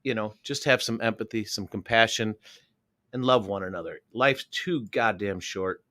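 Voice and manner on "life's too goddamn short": ordinary talk, nothing marked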